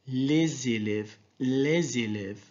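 slow, linked Z in "Les élèves"; liaison. Shown as a demonstration. Teacher repeating the liaison example for learners, slow linked Z in "les élèves". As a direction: draw out "les élèves", joining the words with a Z. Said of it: "il y a une liaison".

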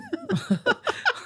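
high, breathy burst of laughter; high giggle. Real, not acted. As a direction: giggle at your own joke. Laughs at his own joke.

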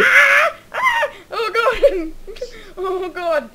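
high screams melting into laughter; scared giddy laughing. Real, not acted. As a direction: shriek and laugh at once in fright. Flung into the air, screaming.

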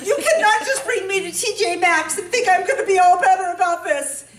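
hammed-up whiny complaint; exasperated complaint. Retold complaint to husband.

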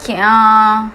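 monotone robot, vowels drawn out; robotic monotone; machine voice. Acted. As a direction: speak like a machine, on one even pitch. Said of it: "machine वाली आवाज़ में".

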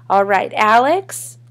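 teacher calling out. A narrator's reading. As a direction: dismiss it and call the next name loudly. Teacher moves on with roll call.

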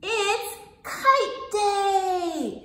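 high, slow and loud, drawn out; excited announcement. Acted. Teacher thrilling the class with kite day. Teacher reads story to children.

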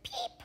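tiny, very high-pitched peep; chick peep. Acted. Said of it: "her chick said".

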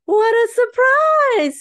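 very high, loud, slow surprised shout; teacher's delighted surprised exclamation; surprised cry. Acted. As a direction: gasp it out, thrilled teacher. Teacher greets surprise party.

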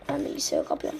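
usual speaking voice

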